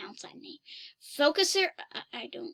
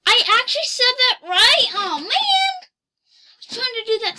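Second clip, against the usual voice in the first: excited; 8 semitones higher, 8 dB louder, faster than usual. Real, not acted. Amazed the app understood him.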